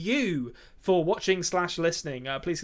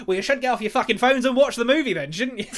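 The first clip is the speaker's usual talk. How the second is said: imitated telling-off, higher and louder; mock scolding director; 6 semitones higher, 5 dB louder than usual. Host as grumpy director blaming millennials, mock scolding. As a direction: scold the youngsters in mock indignation. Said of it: "the generation that you're saying".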